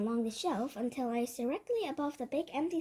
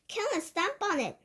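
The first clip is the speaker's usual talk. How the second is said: higher-pitched urgent order; shouting; 6 semitones higher than usual. A narrator's reading. Cooks stamping after the mouse, shouting. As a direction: urge them on with a raised cry. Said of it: "they were shouting".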